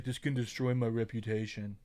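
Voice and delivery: Trump voice; Trump impression. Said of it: "Sorry, I'm doing Trump on accident".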